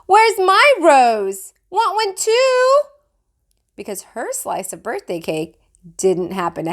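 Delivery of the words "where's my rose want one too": put-on high, loud, slow child's holler; hollering little girl. A narrator's reading. Jenny demanding her rose at the party, high and loud. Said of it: "But Jenny hollered".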